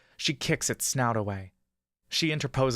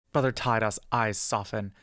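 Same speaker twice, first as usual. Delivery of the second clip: ordinary delivery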